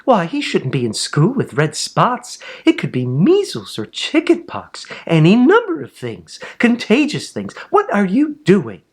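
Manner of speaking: high, rising alarm; alarmed nurse. A narrator's reading. Nurse alarmed that a spotted boy is in school.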